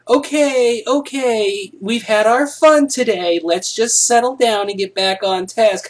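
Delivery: mock prim teacher getting the class back on task. Shown as a demonstration. Teacher ending the fun, high mock-prim voice. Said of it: "teachers can't say".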